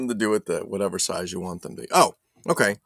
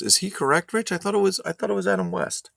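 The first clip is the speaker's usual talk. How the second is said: ordinary talk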